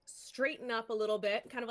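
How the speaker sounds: normal talking voice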